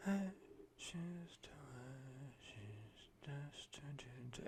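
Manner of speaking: whispered like backing singers, ASMR-style; breathy whisper. Retold or imitated. Reviewer imitating male backing vocals in a whisper. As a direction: hush it right down to a whisper. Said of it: "fucking A S M R levels there".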